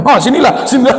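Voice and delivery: high, laughing come here; suddenly eager and chuckling; greedy laugh. Retold or imitated. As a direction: laugh as the million lures him in. Bribed haji suddenly welcomes money.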